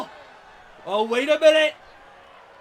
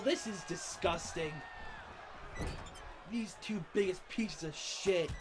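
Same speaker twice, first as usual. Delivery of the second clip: low, much softer contempt; disgusted mutter; 5 semitones lower, 13 dB quieter than usual. Real, not acted. Commentator to fans, softly disgusted at the heels. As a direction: spit it out under your breath.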